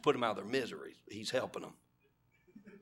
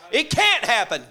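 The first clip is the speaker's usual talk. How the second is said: louder, higher, can't punched; emphatic declaration; 11 semitones higher, 11 dB louder than usual. Acted. Elijah proclaiming to the crowd, loud emphatic declaration. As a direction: proclaim it with full force.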